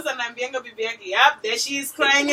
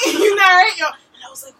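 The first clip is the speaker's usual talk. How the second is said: ordinary talk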